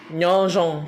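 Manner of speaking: Nyanjan said loud, with a falling tone; Hmong greeting. Shown as a demonstration. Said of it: "qui signifie bonjour en Hmong". (Nyanjan prononcé fort, ton descendant, en hmong)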